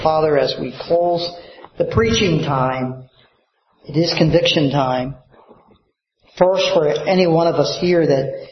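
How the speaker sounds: closing prayer. Acted. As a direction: pray over the bowed congregation.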